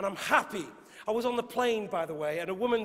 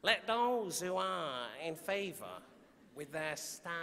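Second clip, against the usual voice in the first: soft, trailing Mancunian accent on "Let those who are in favour with their stars"; Manchester accent; 3 semitones lower, 6 dB quieter, slower than usual. Shown as a demonstration. Poet starting the sonnet in a Manchester accent. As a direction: try the line in a Manchester accent, softer. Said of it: "I wanna read it in a Manchester accent".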